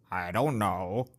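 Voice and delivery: low, curt mock reply; rude lady. Imitating the rude lady brushing off the old shop owner. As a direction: toss it off like a rude customer.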